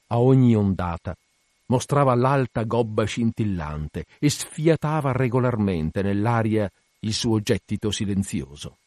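usual speaking voice

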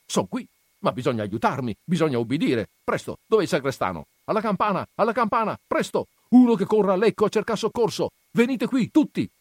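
high, loud urgent commands; rally the men fast; commanding orders. Acted. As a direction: consul barking orders to the crowd. Consul takes charge.

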